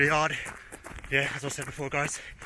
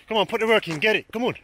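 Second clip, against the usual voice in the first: loud, high instruction; coaching shout; 8 semitones higher, 4 dB louder than usual. Real, not acted. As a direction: bark the tip across the field. Shouting to marchers.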